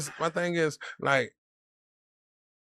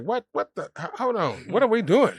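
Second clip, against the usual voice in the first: high-pitched, louder mock disbelief; acted-out bafflement; 9 semitones higher, 4 dB louder than usual. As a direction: stammer into a baffled, half-laughing protest. Acting out own baffled reaction.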